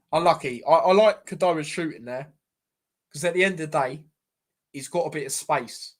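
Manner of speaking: usual speaking voice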